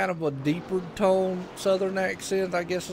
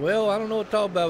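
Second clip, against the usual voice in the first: fast retold reply; 5 semitones higher, 3 dB louder, much faster than usual. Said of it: "I was like".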